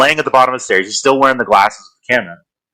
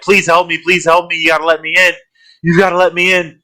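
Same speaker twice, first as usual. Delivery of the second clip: desperate plea; 8 semitones higher than usual. Retold or imitated.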